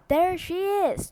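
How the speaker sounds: loud, slow accusing shout. A narrator's reading. Mob leader shouting at the schoolteacher. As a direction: yell it out, pointing her out to the mob. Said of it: "Trot shouted".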